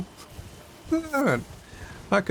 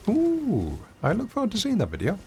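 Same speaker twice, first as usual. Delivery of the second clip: mock eager; 9 semitones higher than usual. Teasing a viewer.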